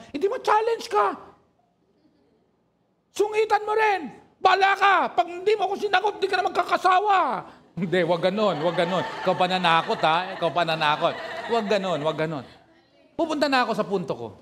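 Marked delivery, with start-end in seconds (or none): 0.1-7.2 much higher, louder, fast hammed-up suitor; bully her into saying yes; playful threatening suitor; mock threat. Acted pushy suitor threat. 7.8-12.4 loud laughing correction. Real, not acted. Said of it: "laughing correction".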